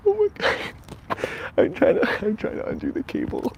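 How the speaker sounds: very high, loud, flustered squeal; high-pitched real fluster; panicked squeal. Real, not acted. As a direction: squeak it out, helplessly tangled. Panicking while tangled in cable.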